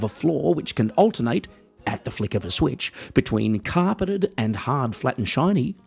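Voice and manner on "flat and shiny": usual speaking voice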